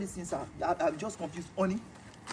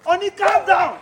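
raised voice, pulling him back; loud plea; 8 semitones higher, 12 dB louder than usual. Acted. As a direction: shout calm down to stop him. Husband restraining angry friend.

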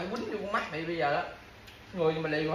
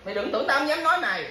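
loud, higher threatening scold; angry warning; 6 semitones higher, 4 dB louder than usual. Real, not acted. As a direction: warn him off, voice raised. Angry scolding at dinner table. (quát to đầy giận dữ)

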